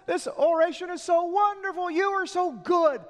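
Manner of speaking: high, loud swooning crowd voice; over-the-top adoring cry from the crowd; gushing praise. Acted. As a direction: gush over him like a dazzled fan. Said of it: "they continue going".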